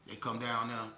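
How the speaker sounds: ordinary talk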